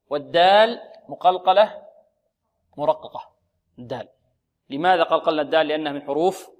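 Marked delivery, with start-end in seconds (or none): none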